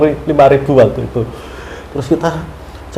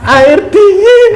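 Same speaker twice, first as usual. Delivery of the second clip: joyful relief; 5 semitones higher than usual. Retold or imitated. Exhausted pilgrim given cold water, joyful thanks. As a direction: savour the cold water gratefully.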